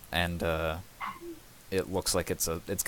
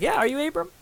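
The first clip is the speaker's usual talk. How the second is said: eager echo; 3 dB louder, faster than usual. Acted. Brother echoes recognition eagerly.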